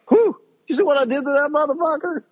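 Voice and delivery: high, hammed-up boast; mock gloating after a shootout, high and cocky. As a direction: brag about the kill, over the top. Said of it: "That's how a normal person would react".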